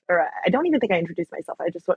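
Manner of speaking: normal talking voice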